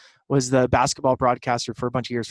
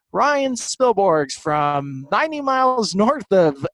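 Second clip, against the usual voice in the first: Vin Scully impression; 7 semitones higher, 4 dB louder than usual. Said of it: "I was like".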